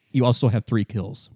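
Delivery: usual speaking voice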